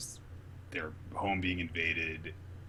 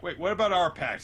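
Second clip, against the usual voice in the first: high, loud put-on indignation; upset mock protest; 11 semitones higher, 10 dB louder than usual. Law objects to Luffy's new pact, upset mock protest. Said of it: "Law is kind of upset about it".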